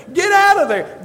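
high, loud command to rise; commanding shout. Acted. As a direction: call the dead man out. Jesus commands the dead man.

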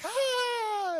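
long, high mock fangirl squeal; sustained high-pitched excited shriek in imitation. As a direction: imitate giddy girls squealing, held high. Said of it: "they're all excited and everything".

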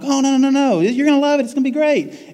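very high, gushing reassurance; imitated perky welcomer; overeager church greeter. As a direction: sweep away the objection in a high voice. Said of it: "I'm like".

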